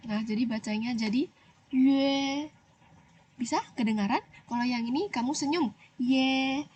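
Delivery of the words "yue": "yue" with rounded lips and a u in the middle; careful yue. Shown as a demonstration. Teacher demonstrating the yue sound to pupils, rounded lips. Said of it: "di tengahnya harus ada huruf u".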